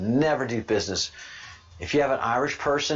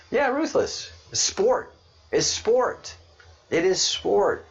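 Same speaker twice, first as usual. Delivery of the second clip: much higher, insistent; higher and keyed up, repeating it; excited; 11 semitones higher, slower than usual. Real, not acted. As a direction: hammer home that it's sport. Excited rant about Irish.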